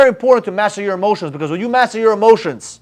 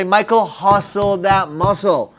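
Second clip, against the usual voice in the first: slow catchphrase; much slower than usual. Retold or imitated. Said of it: "I would say".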